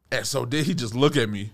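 ordinary delivery

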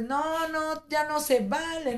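high, drawn-out mock whine; retold tantrum. Wife re-enacting her tantrum at her husband, whiny protest. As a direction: imitate a sulky, high-pitched refusal. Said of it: "hice un mega berrinche".

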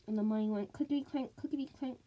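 usual speaking voice

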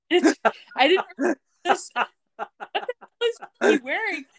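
high, incredulous, cracking up; real laughter breaking through the words; laughing. Real, not acted. Recapper baffled by Jake's costume, laughing incredulously.

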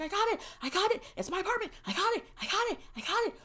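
high, squeaky rapid-fire squeals, held back in volume; mock screaming. Daughter tells mom she got the apartment, mock screaming. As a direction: repeat it like a miniature scream of joy. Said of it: "screaming at the top of my lungs".

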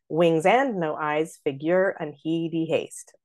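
slow, "Wings" stressed first; trochee on wings. Shown as a demonstration. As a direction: start the line on a strong beat, "Wings". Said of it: "you can see the trochee at the top".

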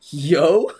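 high, loud baffled yo; a sharp questioning yo; incredulous yo. Retold or imitated. Reading her text message in disbelief.

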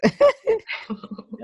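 high airy giggle; relieved laugh. Real, not acted. Relieved after pronunciation.

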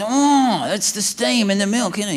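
mildly hammed-up discovery; excited. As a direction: marvel as the secret dawns. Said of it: "he's so excited".

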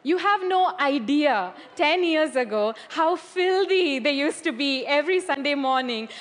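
normal talking voice

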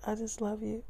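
put-on high, soft girlfriend voice; voicing his girlfriend. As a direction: play her sweet and hushed. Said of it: "She's like".

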